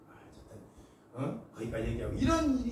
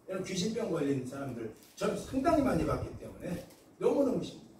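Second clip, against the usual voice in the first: usual speaking voice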